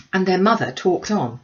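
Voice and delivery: usual speaking voice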